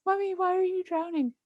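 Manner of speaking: high, alarmed child voice, imitated; freaked-out little girl. Mom imitates her scared daughter at the door, high and alarmed. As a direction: play the kid pleading at the door. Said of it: "getting so freaked out".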